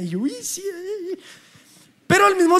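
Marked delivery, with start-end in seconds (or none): 0.3-1.2 mock half-hearted yes, high and much softer; a lazy, unwilling "yeah, uh"; reluctant mumble. Desganado ante el profe. (sí desganado, agudo y muy suave)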